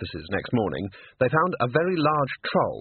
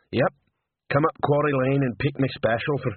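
simple troll recounting his hiring; a touch of troll, talking plainly; lightly voiced troll; 3 semitones higher than usual. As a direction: play a simple-minded troll.

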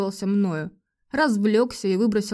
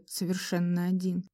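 usual speaking voice

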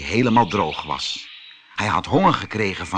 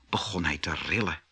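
ordinary delivery, nothing marked

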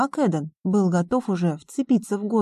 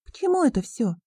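put-on higher-pitched voice of a challenging girl; questioning young heroine; 8 semitones higher, faster than usual. A narrator's reading. Girl questions a businessman about his motives, challenging. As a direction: ask it high, voiced as the girl.

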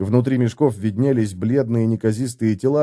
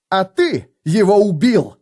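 higher, louder accusing blow; cutting accusation; 8 semitones higher, 4 dB louder than usual. A narrator's reading. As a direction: hurl the accusation. (Феликс с вызовом обвиняет Готрека)

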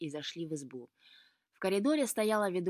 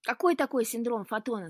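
loud, higher-pitched query; puzzled question; 4 semitones higher, 7 dB louder than usual. A narrator's reading. Luka questioning the cat's odd excuse, puzzled. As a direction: demand to know what on earth that is. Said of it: "спросил Лука".

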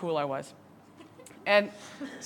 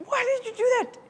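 exasperated question; 14 semitones higher than usual. Retold or imitated. Imagined angry retort.

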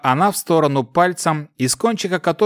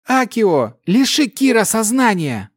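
shouting; 8 semitones higher than usual. A narrator's reading. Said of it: "закричала Гвил".

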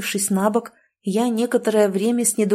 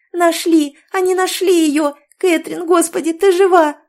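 much higher and louder, overlapping joyful cries; excited shouting; 9 semitones higher, 6 dB louder than usual. A narrator's reading. Friends finding Catherine alive, loud and high excited cries. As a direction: friends bursting with relief. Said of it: "наперебой кричали подруги".

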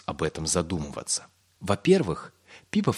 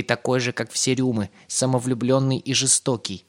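high, loud reproach; accusing; 6 semitones higher, 4 dB louder than usual. A narrator's reading. Yosl accuses Pip from an oak branch, accusing. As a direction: hurl the accusation, pitched up.

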